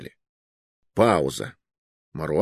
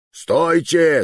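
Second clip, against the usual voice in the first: loud, higher-pitched shout to halt; yelled command; 7 semitones higher, 5 dB louder than usual. A narrator's reading. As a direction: yell after fleeing prisoners. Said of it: "заорал Ганс". (громкий окрик)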